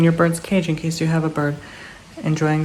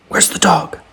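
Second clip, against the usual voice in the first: whisper; 3 semitones higher than usual. Real, not acted. Teasing the dog in whisper.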